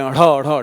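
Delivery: ढ़ said twice with a breathy tongue flap; ढ़ flapped; flapped ढ़ demo. Shown as a demonstration. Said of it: "जब आप ढ़ बोलें".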